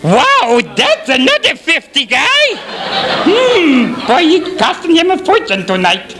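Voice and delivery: very high puppet voice; excited puppet chatter; puppet character impression. Puppet impressions for guessing game.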